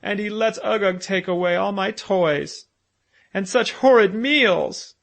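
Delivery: put-on high, loud wailing child; tearful little boy crying out; sobbing complaint. A narrator's reading. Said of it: "he sobbed".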